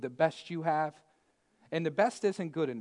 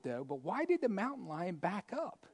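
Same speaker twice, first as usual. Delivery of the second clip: ordinary talk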